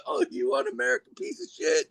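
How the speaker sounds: a high, mock outraged jeer from a fan at the Russian wrestler; angry fan. Arena crowd yelling at villain.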